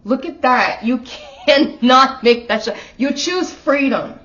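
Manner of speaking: overcome, pushing the words out slowly; getting choked up and loud; emotional. Real, not acted. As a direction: voice rising high and loud with emotion. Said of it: "Now I'm getting emotional".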